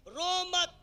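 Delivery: loud, high mock order; hammed-up scolding yell; commanding shout. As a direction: shout it like an exasperated elder. Acts elder ordering child not to cry.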